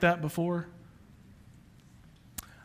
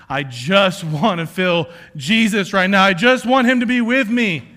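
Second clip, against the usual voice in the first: longing cry; 4 semitones higher, 7 dB louder than usual. Retold or imitated. Disciples' longing voiced.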